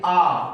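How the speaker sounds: "आ" dengan mulut terbuka lebar; open-mouth vowel. Shown as a demonstration. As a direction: open wide on "आ", held long. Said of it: "मुंह जो है वो थोड़ा सा खुला रहना चाहिए".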